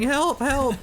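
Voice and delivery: high-pitched mock plea, hammed-up; mock cry for help. Caster voicing the fleeing unit, mock panicked cry. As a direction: play the fleeing unit's panicked plea, lightly. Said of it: "he's saying".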